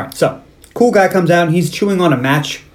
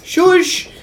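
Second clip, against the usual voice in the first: high-pitched drawn-out shush; mock shush. Cobra hushing the unguarded woman, high mock shush.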